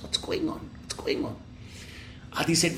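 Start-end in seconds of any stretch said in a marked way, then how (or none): 0.0-1.4 hushed, bewildered whisper; confused whisper. Acted. Two confused prisoners amid the chaos, confused whisper. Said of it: "Both Ballu and Adi were confused".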